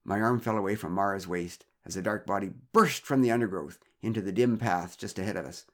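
plain reading voice

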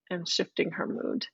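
usual speaking voice

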